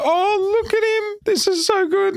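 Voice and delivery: hammed-up proud father cooing at a baby; mock gushing. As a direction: gush like a new dad at the nursery window.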